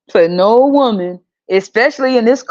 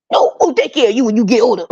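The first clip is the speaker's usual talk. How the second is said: mocking relative voice; 3 semitones higher, faster than usual. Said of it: "everybody ends up talking just like this".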